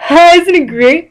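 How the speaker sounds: high, loud gleeful cry; laughing delight. A narrator's reading. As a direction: gush it with goofy delight. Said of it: "Patrick laughed".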